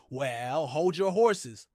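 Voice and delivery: ordinary talk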